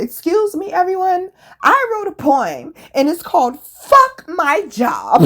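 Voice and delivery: high, loud and slow proclamation; mock formal public address; loud announcement. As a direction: announce it to the whole room from a chair. Standing on chair announcing poem.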